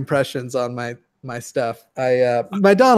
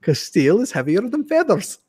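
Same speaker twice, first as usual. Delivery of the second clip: higher-pitched, self-assured mock explanation; smug sketch character; 6 semitones higher than usual. Quoting the sketch guy's certain verdict, higher and smug. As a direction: state the absurd fact as if it's obvious. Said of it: "and he goes".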